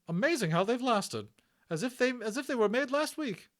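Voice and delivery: high loud brisk nurse voice; a bustling hospital matron; hearty Matron. Acted. Matron reassuring Omri about the Indian, high and hearty.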